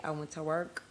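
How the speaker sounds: usual speaking voice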